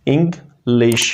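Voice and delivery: इंग्लिश drawn out slowly, every sound clear; slow model pronunciation. Shown as a demonstration. Teacher slowly modelling English for students. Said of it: "similar उसी तरह की sound".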